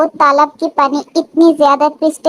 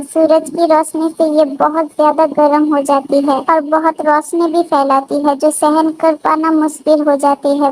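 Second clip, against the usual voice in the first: ordinary delivery, nothing marked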